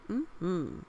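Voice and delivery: usual speaking voice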